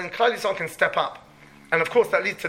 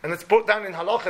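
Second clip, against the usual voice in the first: ordinary talk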